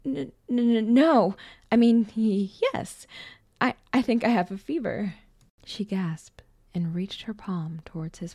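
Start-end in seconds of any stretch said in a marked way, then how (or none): 0.0-5.1 nervous stammer, higher and louder; stuttering. A narrator's reading. Boy asked if he was sick, stuttering. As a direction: trip over the first sounds, voice raised. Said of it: "he stuttered".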